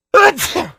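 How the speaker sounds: sudden loud sneeze into the mic; real explosive sneeze; allergy sneeze, high and loud. Real, not acted. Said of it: "Sneezing into the mic".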